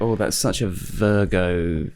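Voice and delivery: slow, drawn-out mock astrology girl; lightly voiced astrology fan, slow and knowing. Mocking astrology girls to a friend, slow knowing mock voice. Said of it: "They talk about the star signs".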